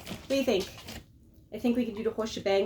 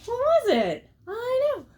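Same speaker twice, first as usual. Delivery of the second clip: much higher, coaxing sympathy; cooing to the dog; 13 semitones higher, 4 dB louder, slower than usual. Pretend. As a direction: coo at the dog with exaggerated sympathy.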